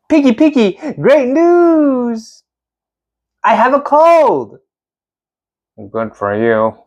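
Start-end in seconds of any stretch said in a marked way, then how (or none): 0.1-4.6 high, bright, excited announcement; overjoyed news. Acted. Gerald telling his friend Piggy he only has a cold, overjoyed. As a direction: burst with joy at the news.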